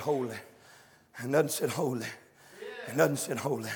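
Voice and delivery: soft low holy; hushed angels. Acted. Angels crying holy to one another, hushed. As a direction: murmur each holy softly.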